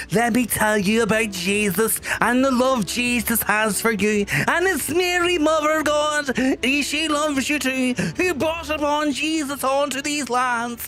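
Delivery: hammed-up preaching at the chat; mock preacher. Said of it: "wanna come in and be like".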